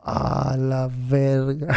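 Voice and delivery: very slow, drawn-out dismay; stunned reaction. Retold or imitated. Storyteller hears the wedding is cancelled, stunned drawn-out curse. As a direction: stretch every word out as it sinks in.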